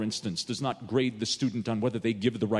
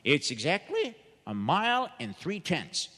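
proud; 4 semitones higher than usual. Acted. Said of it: "He proudly announced".

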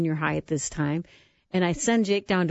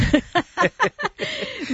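laugh; 4 dB louder than usual. Real, not acted. Laughing at brothers' quote.

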